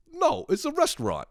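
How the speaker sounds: mock-condescending, very high-pitched. Stranger correcting the person he asked, mock condescension. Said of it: "he kinda gives me the look".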